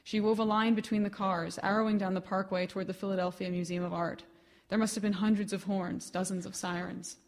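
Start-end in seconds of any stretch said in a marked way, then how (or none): none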